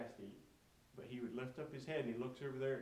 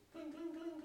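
high-pitched "cling, cling, cling" of kibble; imitated metal clinking; slower than usual. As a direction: imitate food dropping into a metal pan. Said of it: "it makes that noise".

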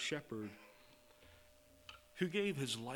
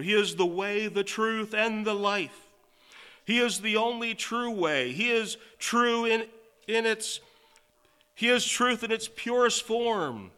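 higher, louder sermon voice; preacher declaring Jesus the only way, full force; preaching at full force; 8 semitones higher, 10 dB louder than usual. Acted. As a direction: declare it with conviction. Preaching to congregation.

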